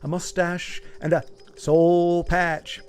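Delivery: slowed, deliberate pointing out; patient mock lecture on a mustache; slow explaining. Real, not acted. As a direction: spell it out slowly. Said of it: "explaining his facial hair".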